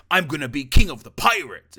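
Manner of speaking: loud, forced-out mock of a stiff live action hero line; forced anime line. Mocking the actor who forces the words out, loud strained delivery. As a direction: push every word out like a strained hero shout. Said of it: "he forces the words out".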